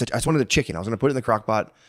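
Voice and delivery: ordinary talk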